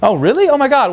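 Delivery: flattered squeal. Retold or imitated. Flattered girl hearing she's hot, high delighted squeal.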